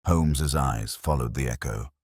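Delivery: ordinary delivery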